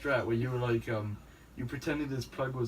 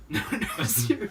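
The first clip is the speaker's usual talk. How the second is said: laughing; 3 semitones higher, 5 dB louder than usual. Retold or imitated. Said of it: "He's like".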